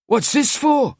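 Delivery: high, frightened plea; almost pleading. Acted. Girl at car boot to captor, high frightened plea. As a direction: plead with rising terror. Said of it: "She was almost pleading".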